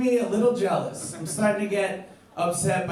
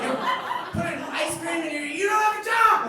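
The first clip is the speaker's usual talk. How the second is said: incredulous rant; 9 semitones higher, faster than usual. Retold or imitated. Ranting at the jobless roommate about ice cream coffee, outraged high yell. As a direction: blow up at him in outrage.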